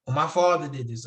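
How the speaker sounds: normal talking voice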